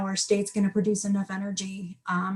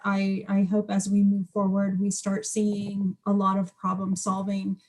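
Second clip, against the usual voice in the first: usual speaking voice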